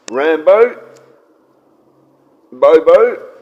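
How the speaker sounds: loud, rising call of each name; calling the dogs. Real, not acted. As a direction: sing out to get the dogs' attention.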